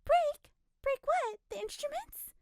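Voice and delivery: high, loud baffled echo; nosy woman, bewildered; perplexed. A narrator's reading. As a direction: repeat it in confusion. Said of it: "queried perplexedly".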